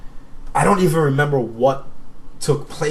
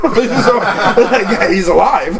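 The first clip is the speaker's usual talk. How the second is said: high, loud talk through laughter; laughing; 7 semitones higher, 7 dB louder, slower than usual. Real, not acted. Storyteller baffled by his friend's cancer claim, laughing loudly. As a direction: crack up while getting the words out.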